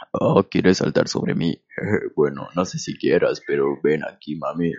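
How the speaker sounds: very low, soft, slow seductive voice; sultry TikToker. Retold or imitated. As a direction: breathe it out like a smug flirt. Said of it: "lee según él sus comentarios".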